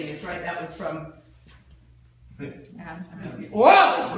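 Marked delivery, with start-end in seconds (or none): 3.5-4.1 loud, high whoa; surprised whoa. Real, not acted. Speaker reacting to the crowd, loud surprised whoa. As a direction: burst out in surprise.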